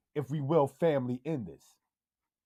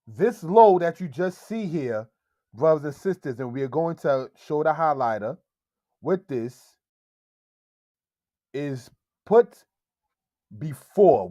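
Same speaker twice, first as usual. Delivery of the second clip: ordinary delivery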